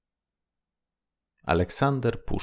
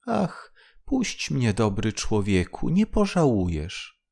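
tiny pleading voice. Acted. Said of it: "głosik cieniutki, błagalny".

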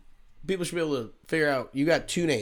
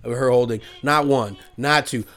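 booming fight announcer voice, louder; Bruce Buffer; 7 dB louder than usual. Acted. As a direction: hype it like a ring announcer. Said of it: "That's my Bruce Buffer announcer voice".

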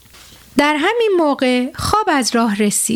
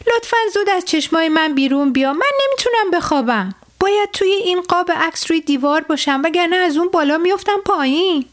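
higher, fast pleading rabbit; upset rabbit; 5 semitones higher, faster than usual. Acted. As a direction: beg sleep to leave your eyes. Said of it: "با ناراحتی به خواب گفت".

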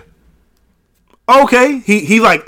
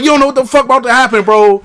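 cocky laughing warning; 7 semitones higher, 3 dB louder than usual. Retold or imitated. Retells cocky threat laughing.

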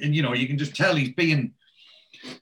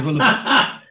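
a hint of a louder, higher Oz voice; imitating Oz; 9 semitones higher, 7 dB louder than usual. Retelling Oz mocking the landlord, lightly voiced. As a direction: lightly put on the cocky character voice. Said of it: "He got his voice off perfect".

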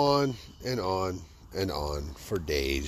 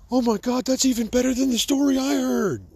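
high, loud, gushing "oh my God"; gushing customer; 13 semitones higher, 5 dB louder than usual. Retold or imitated. Customer squealing to storyteller, high and loud excited. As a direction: squeal like an impressed fan.

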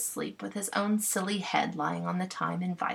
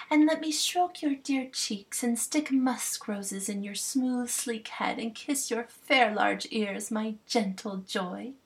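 slow, higher, adoring queen; doting fairy queen; 4 semitones higher, slower than usual. Acted. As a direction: coo it like a smitten queen. Titania voiced tenderly.

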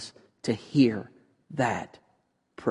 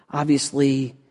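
usual speaking voice

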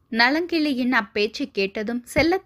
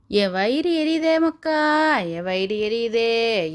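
drawn-out wailing; slower than usual. A narrator's reading. Grandmother laments to her granddaughter, slow drawn-out wail. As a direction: stretch each word into a lament. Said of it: "என்று நீட்டி முழங்கவும்".

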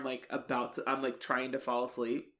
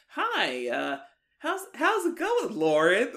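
awkward, high-pitched greeting; weird parents; 8 semitones higher, 6 dB louder than usual. Retold or imitated. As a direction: imitate parents greeting stiffly, pitch jumping up. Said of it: "They're always, like, very weird".

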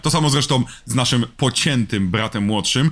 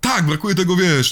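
usual speaking voice

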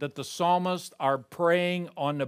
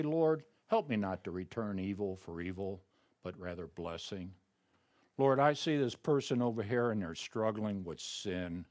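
soft, softer, intimate murmur to God; hushed prayer; 7 semitones lower, 7 dB quieter than usual. Shown as a demonstration. Preacher modelling his own private prayer, softly. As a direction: pray it quietly, as if alone before the throne.

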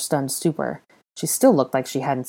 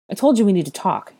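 higher, louder insistence; firm reminder; insistent repeat; 5 semitones higher, 5 dB louder than usual. A narrator's reading. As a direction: press the point with raised voice. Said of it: "Harry repeated".

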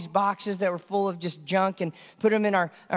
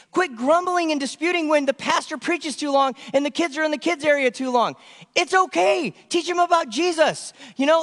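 raised, ringing exhortation repeating the charge; preaching at full force; 7 semitones higher than usual. Acted. Pastor exhorting the congregation to stop grumbling: raised preaching. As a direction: drive the refrain home like a preacher.

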